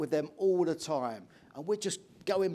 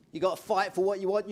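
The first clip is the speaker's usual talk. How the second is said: ordinary talk, nothing marked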